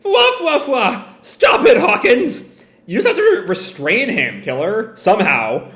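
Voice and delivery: high pleading voice broken by laughter; laughing pleading Killer. Acted. Killer begs crew while cursed laughter bursts out. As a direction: beg through uncontrollable giggles. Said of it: "can't stop laughing".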